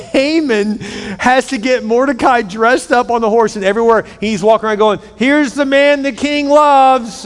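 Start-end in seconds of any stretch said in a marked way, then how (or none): 5.2-7.3 high, slow, grudging announcement; hammed-up furious town crier; angry proclamation. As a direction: call it out drawn-out while seething. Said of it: "he's so angry doing".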